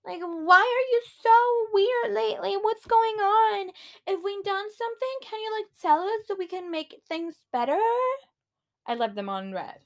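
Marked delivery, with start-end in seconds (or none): 0.0-7.8 much higher, whiny mock voice; mocking friends' text. Vlogger mocking worried friends' text, high simpering. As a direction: read it in a simpering voice. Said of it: "texted me saying".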